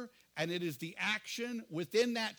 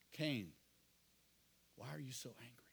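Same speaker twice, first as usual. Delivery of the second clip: softer and whispered, as God to Cain; whispered fatherly question; 6 semitones lower, 10 dB quieter than usual. Retold or imitated. God whispering to Cain before the murder: hushed fatherly question. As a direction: hush it like a worried father. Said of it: "What he tells Cain is".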